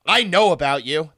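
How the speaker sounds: ordinary talk, nothing marked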